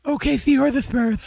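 high, lisping put-on voice; mocking imitation. Co-host finishing a parody of a colleague, high lispy mockery. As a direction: mimic him high and lispy. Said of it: "You were like".

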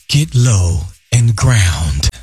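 slow, low meditation voice; smooth radio voice. Shown as a demonstration. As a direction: ease the words out slow and smooth. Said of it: "I get the smooth radio voice".

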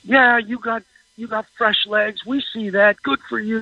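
high-pitched mock heckling at ordinary volume; Mason McCormick. Sportswriter quotes a lineman needling the returning veteran, mock yelling. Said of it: "he's yelling".

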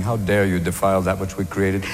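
low, quiet, solemn rebuke; stern George Washington. Acted. Founding father rebukes Osama at heaven's gates, stern. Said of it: "There'll be George Washington standing".

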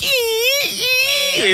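high squeaky balloon noise, ee ee ee; Pee Wee balloon squeak. Retold or imitated. Mimicking Pee Wee Herman's balloon for the cohost, high squeak. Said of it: "Pee Wee Herman with the balloon".

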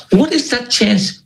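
high, slow amazement; amazed. Real, not acted. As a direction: marvel at it slowly. Marvelling at the coincidence.

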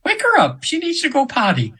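very high, lilting imitation of an angel; imitating channeled angel. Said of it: "I'm almost using her voice now".

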